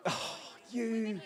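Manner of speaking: normal talking voice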